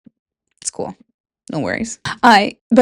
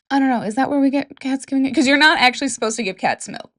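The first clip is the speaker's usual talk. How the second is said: ordinary talk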